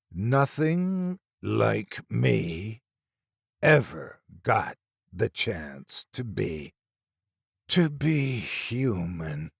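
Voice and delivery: a rotting thing leaning in close; slow creature voice. A narrator's reading. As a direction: creep the words out. Monster speaks in story.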